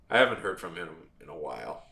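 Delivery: ordinary delivery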